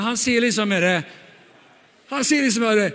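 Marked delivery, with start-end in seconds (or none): none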